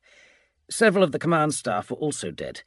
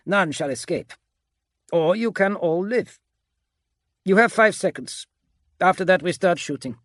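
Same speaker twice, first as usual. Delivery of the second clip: stern ultimatum in a raised, higher voice; commanding soldier Agapito; 7 semitones higher, 4 dB louder than usual. A narrator's reading. Agapito demands surrender from captured officers, commanding ultimatum. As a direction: lay down terms of surrender.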